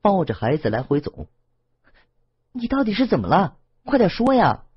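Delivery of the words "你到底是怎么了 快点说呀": much higher, urgent pleading; anxious urging. A narrator's reading. Lan Feng begging the crying Wang Tong to speak, high anxious urging. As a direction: press her desperately for an answer. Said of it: "蓝峰是真的急了".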